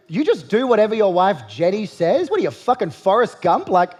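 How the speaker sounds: ordinary talk, nothing marked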